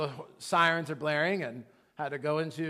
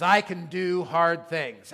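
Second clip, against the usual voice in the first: slow, loud, word-by-word tagline; slogan for repeating; 7 dB louder, slower than usual. Shown as a demonstration. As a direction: declare it firmly for a crowd to echo. Quotes tagline for congregation to repeat.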